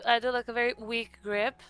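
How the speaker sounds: ordinary delivery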